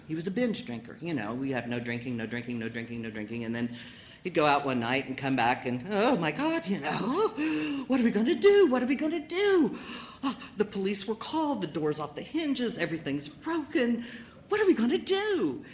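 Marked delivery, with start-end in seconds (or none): 5.9-15.6 high frantic panic, voice climbing; panicked. Retold or imitated. Wife panicking to drunk husband about the wreckage, high and frantic. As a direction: mimic her own frantic panic, much higher. Mimicking own panicked past self.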